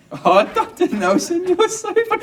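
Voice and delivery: very high, loud mock nurse voice; drawn-out falsetto, brushing off a joke; put-on tittering nurse. Nurse thinking the doctor is joking, high put-on voice.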